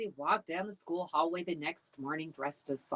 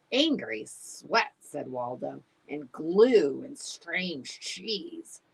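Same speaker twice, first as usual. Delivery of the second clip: drawn-out sniffing description; slow, savoring; 3 dB louder, slower than usual. Acted. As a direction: list smells slowly. Dog describing bully smell.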